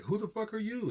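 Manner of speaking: high, indignant mock outburst; mock indignant retort. Imitating a rude visitor's hostile retort, mock indignant. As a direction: throw it back like an offended stranger. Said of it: "it's like".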